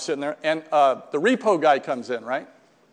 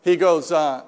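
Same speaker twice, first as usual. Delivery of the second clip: usual speaking voice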